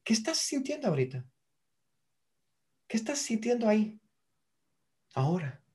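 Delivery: calm hypnotist question; soft probing question. Shown as a demonstration. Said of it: "Esta pregunta es buenísima".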